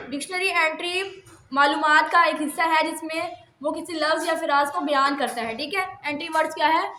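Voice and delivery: usual speaking voice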